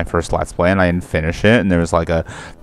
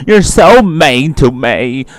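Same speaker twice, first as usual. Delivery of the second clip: higher, slow mock-hurt voice; Sam impression; 5 semitones higher, 4 dB louder, slower than usual. Repeating Sam's imagined complaint, slow mocking impression. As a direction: stretch out a put-on complaint. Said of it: "She's like".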